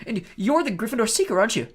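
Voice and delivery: put-on high, awed boy voice; in awe. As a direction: ask full of wonder. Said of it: "said Colin in awe".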